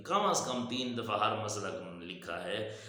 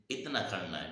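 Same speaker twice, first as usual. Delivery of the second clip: normal talking voice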